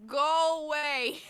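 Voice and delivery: slow, loud brush-off; dismissive. Acted. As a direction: shoo them away, drawn out.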